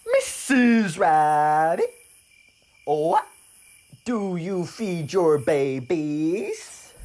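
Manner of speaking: loud, high, very drawn-out frog voice; curious frog. Acted. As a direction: stretch every word into a big booming question.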